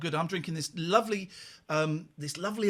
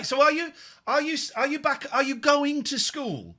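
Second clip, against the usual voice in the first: high, slowing question; 8 semitones higher than usual. Real, not acted. Host asking young girls about school, high and careful.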